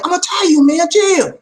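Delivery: high-pitched hammed-up protest; worked-up protest. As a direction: pitch it way up like someone losing their cool. Mock argument about insecurity.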